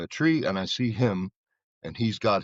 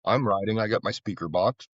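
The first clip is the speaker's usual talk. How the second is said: ordinary talk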